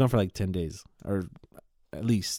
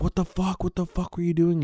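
angry driver; 8 semitones higher than usual. Retold or imitated. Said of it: "he's like".

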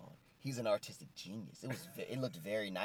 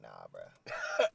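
low lazy refusal; 3 dB louder than usual. Retold or imitated. Roddy turning down his friends, low and lazy. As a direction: drop way down, a laughing low nah bruh.

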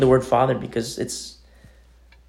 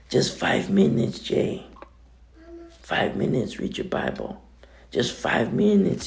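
put-on pastor's Spanish accent; 3 semitones lower, 7 dB quieter than usual. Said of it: "he was in his accent".

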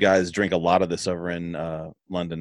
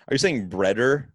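American accent; 3 semitones higher than usual. Shown as a demonstration. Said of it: "in my accent".

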